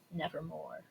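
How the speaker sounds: low, soft croak of a raven. Acted. Raven answering the man's question, low and hollow. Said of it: "The raven says".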